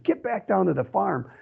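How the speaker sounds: half-hearted shout at the dog; retold yell. Hunter ordering his dog home, retold yell. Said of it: "And as I yelled".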